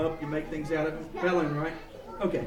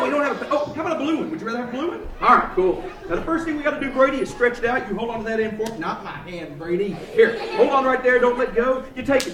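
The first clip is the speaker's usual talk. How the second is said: ordinary delivery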